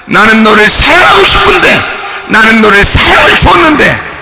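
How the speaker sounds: loud, high-pitched impassioned sermon as God's voice; full-force preaching. Acted. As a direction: preach it at a shout, rising in pitch. Preaches as God speaking. (하나님의 말씀으로 힘껏 외치는 설교)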